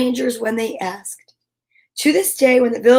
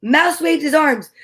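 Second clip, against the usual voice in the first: ordinary delivery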